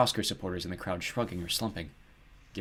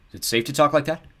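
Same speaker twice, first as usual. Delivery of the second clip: higher, louder anxious question; 6 semitones higher, 7 dB louder than usual. A narrator's reading. Mister Talbot checking it is safe to talk, anxious. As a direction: ask it with a touch of worry. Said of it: "asked, glancing around anxiously".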